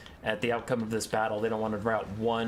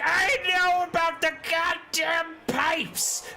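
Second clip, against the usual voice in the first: high, loud, slow angry screech; shrieking rat warlord yelling back; furious ratman snap; 4 dB louder, much slower than usual. Acted. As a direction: bite his head off. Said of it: "And Ickett's like".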